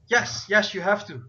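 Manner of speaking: put-on insistent high-pitched kid; boy's voice. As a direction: press the point as an excitable boy. Same boy answering the slime.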